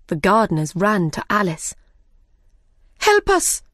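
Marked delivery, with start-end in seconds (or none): 3.0-3.6 cry for help. Acted. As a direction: beg Alice to save them. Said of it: "they cried".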